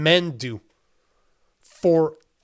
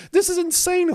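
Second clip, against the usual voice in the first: much higher, stunned disbelief; incredulous; 14 semitones higher than usual. Real, not acted. Streamer reacting to scandal, incredulous pitch jump. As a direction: throw it up high in shock.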